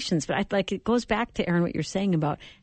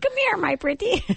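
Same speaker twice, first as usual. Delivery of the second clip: cackling witch voice, much higher; old hag; 14 semitones higher than usual. Pretend. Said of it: "I was thinking Wicked Witch of the West".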